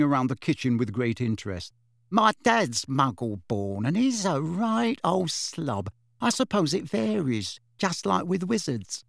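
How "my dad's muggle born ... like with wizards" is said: higher, chatty witch voice. Acted. Witch telling Harry about her dad, chatty. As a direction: play a breezy, talkative witch.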